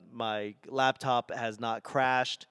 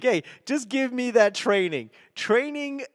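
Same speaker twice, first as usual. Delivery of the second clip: higher, mock-eager pitch; mock eager demand; 10 semitones higher, 4 dB louder than usual. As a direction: call it out like a hyped trainee. Mock-eager quote to an employer.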